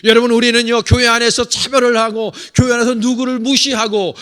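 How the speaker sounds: loud, rousing pulpit delivery; full-force preaching. Acted. Pastor exhorting his congregation, loud and rousing. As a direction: preach it out to the pews.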